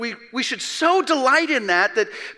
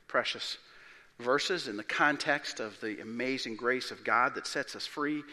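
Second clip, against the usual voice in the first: ordinary talk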